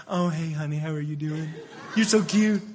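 sweet, gushing mock baby talk to a sweetheart; girlfriend voice. A guy coos at his girlfriend on the phone, mock sweet voice. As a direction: coo it like a smitten boyfriend, hammed-up. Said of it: "they got the girlfriend voice".

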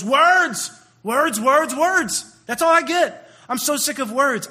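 loud, high, fed-up cry; hammed-up exasperation; exasperated outburst. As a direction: cut in loudly, sick of hearing it. Eliza fed up, cuts suitor off.

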